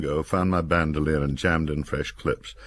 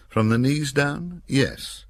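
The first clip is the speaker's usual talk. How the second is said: dry tease; 12 semitones higher, slower than usual. A narrator's reading. Narrator teasing Nick.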